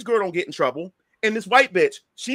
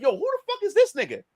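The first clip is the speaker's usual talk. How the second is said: raised pitch, incredulous call-out; retold indignant challenge; 7 semitones higher than usual. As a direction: demand an answer in a higher voice. Repeats his challenge while pointing.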